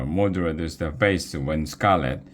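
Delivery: usual speaking voice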